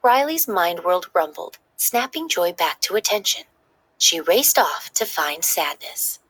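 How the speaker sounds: ordinary delivery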